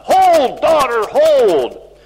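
loud, high shout, easing slightly at the end; sudden cry. Retold or imitated. Dying Robert Bruce calling to his daughter at breakfast, crying out. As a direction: cry out with drawn-out "hold". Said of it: "suddenly he cried out".

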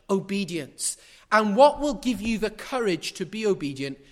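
loud, declaiming scripture; preaching at full force. Acted. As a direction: read it out like a proclamation.

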